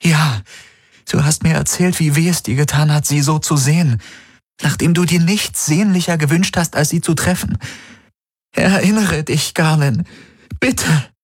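high, begging plea; pleading. Acted. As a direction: beg him to remember. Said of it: "flehte sie".

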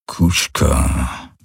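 deep grunt of an alien rider; growling creature. A narrator's reading. Hocks peers through the spyglass, growls low. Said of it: "brummte er".

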